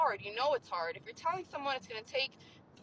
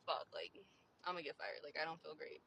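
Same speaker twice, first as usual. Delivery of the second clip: low, soft, deflated mumble to self; defeated inner voice, very soft; dejected self-talk; 9 semitones lower, 12 dB quieter than usual. Retold or imitated. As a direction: sink into quiet discouragement. Voices own discouraged inner thought.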